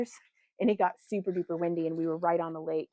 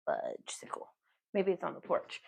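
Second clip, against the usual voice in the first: whispering; 3 semitones lower, 7 dB quieter than usual. Real, not acted. Muttering alone, whispered.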